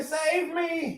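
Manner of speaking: high mock cry; wailing mock plea; fluttering cries for help.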